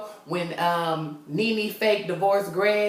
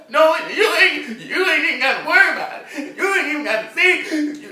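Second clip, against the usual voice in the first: high, loud, laughing trembly voice; hammed-up quivering voice, much higher and louder; 8 semitones higher, 5 dB louder than usual. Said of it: "that voice get to trembling".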